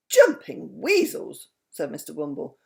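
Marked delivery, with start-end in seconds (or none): 0.1-1.5 high, slow, surprised cry; surprised exclamation. A narrator's reading. Mister Wumble startled by the dragon news, a slow surprised cry. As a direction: draw out the surprise. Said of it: "often said strange things when he was surprised".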